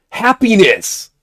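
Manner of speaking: slow, high drawn-out exclaim; exclaimed happiness. Shown as a demonstration. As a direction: say it like pure delight. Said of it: "what if you exclaim".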